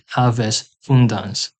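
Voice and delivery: slow, careful Gothic; Gothic pronunciation. Shown as a demonstration. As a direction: spell out each sound of "Haves hundans". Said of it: "we'd say".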